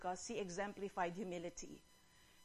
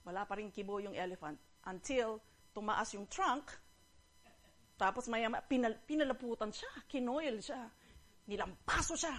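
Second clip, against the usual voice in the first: ordinary delivery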